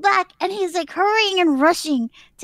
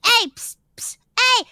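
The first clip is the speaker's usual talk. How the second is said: calling for attention; 7 semitones higher than usual. Retold or imitated. Imitates man calling after her.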